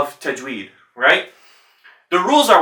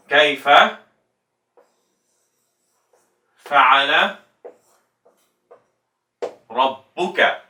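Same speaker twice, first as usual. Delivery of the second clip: slow melodic recitation, word by word; recited verse; 3 semitones lower, slower than usual. Shown as a demonstration. Teacher reciting the verse for students. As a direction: recite it in tajweed style. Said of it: "if I say".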